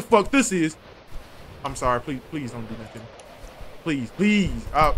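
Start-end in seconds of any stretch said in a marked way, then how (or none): 1.6-4.6 frightened pleading. Real, not acted. Begging monster in horror game.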